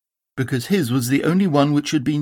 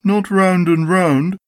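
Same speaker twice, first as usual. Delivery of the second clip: slow, raised-pitch dismissive Eeyore; cold Eeyore; 6 semitones higher, 3 dB louder, slower than usual. A narrator's reading. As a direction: correct slowly as affronted Eeyore. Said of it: "said Eeyore coldly".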